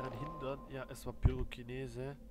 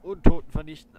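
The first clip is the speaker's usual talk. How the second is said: loud spell-casting shout; triumphant shout; 4 semitones higher, 20 dB louder than usual. Real, not acted. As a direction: call out the spell name.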